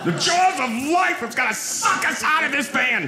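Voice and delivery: very high, hammed-up angry yell; mock furious rant. Passenger fuming at one-armed driver, high mock-furious rant. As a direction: erupt in comic rage, pitch way up. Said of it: "I'm getting angry".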